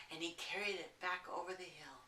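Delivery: plain reading voice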